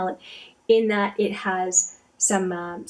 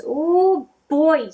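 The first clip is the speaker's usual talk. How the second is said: wowed exclamation; 8 semitones higher, 7 dB louder than usual. Real, not acted. Vlogger seeing the blingy swatch appear, wowed and drawn out. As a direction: let it burst out long and impressed.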